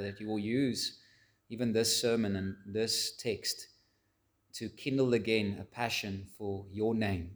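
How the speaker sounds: soft, low-voiced pastoral prayer. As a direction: pray quietly and humbly before God. Opening prayer before the sermon.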